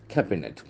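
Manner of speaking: Cabinet with the accent on CAB; first-syllable stress. Shown as a demonstration. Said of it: "The accent falls on the first syllable".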